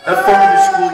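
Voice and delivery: loud, high angry yell; shouting. Real, not acted. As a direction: bellow it in anger. Angry outburst at the others.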